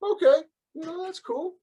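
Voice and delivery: put-on high voice imitating a girlfriend's reply; imitated girlfriend. Girlfriend answers news of the meditation, imitated in high voice. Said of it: "And she was like".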